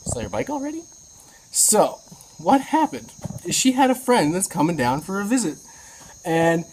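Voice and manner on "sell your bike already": high, soft, disbelieving; lightly voiced amazed question; surprised. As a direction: ask it half-stunned, pitched way up. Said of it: "I'm just like".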